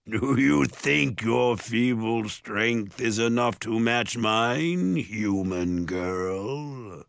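slow, booming monster sneer; ogre taunt. A narrator's reading. Ogre mocking Mallory's strength, booming taunt. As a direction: taunt slowly, drawing out the last words.

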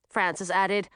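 usual speaking voice

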